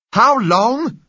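very loud, high yell; shout. Acted. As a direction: bellow the question back. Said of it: "shouted the man on our side".

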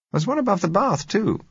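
high woman's voice; female character voice. A narrator's reading. Josella voiced.

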